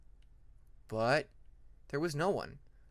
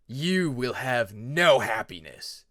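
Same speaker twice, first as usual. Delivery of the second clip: slow, loud, dark threat; menacing dragon; 3 semitones lower, 6 dB louder, much slower than usual. Acted. Shadow dragon threatening Peacemaker, slow and loud. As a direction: pronounce his doom slowly.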